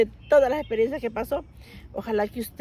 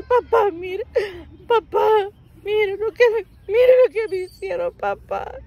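put-on high, loud tearful child's plea; crying to dad; 8 semitones higher, 7 dB louder than usual. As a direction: wail it to papa, showing the bruises. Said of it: "y yo llorando". (niña llorando, aguda y fuerte)

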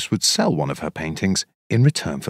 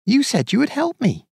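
much higher, hurt little mouse; pleading Mouse. Acted. Mouse reminding Bear she shared her food, pleading and high. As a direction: protest like a wronged small mouse.

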